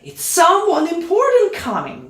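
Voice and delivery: high, excited fanfare announcement; heralding. Acted. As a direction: announce an important arrival, pitched high. Acts out fanfare calling for attention.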